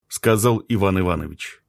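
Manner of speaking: plain reading voice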